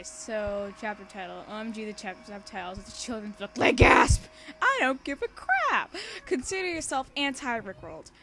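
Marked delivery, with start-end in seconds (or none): 3.6-8.1 hammed-up yell at the reader; over-the-top sarcastic shout; mock outburst. Mocking author note, shouted.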